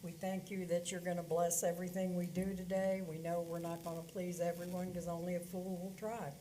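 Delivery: ordinary delivery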